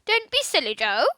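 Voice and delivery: a parrot mimicking the boy; parrot imitating Philip. Kiki the parrot mimics Philip to Joe, squawking. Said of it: "in an exact imitation of Philip's voice".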